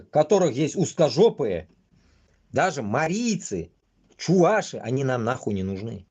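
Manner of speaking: ordinary talk, nothing marked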